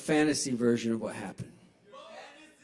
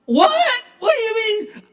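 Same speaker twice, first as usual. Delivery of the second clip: mock panicked shriek; 10 dB louder, slower than usual. Beaten man panics.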